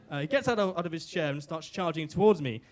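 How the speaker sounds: ordinary talk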